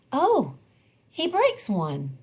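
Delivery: ordinary delivery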